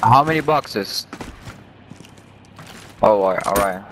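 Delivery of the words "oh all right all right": ordinary delivery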